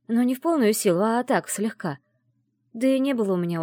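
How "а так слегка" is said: usual speaking voice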